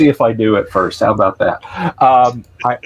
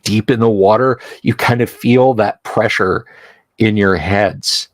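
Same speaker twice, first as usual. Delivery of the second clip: ordinary delivery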